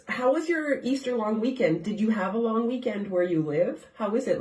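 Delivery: ordinary talk, nothing marked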